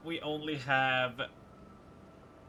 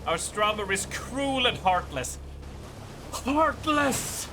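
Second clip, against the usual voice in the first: mock outrage; 8 semitones higher than usual. Mock denouncing game character.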